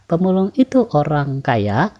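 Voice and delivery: "Pemulung itu orang kaya" as a yes-no question, lifting at the end; rising question intonation. Shown as a demonstration. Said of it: "intonasi naik yang membutuhkan jawaban".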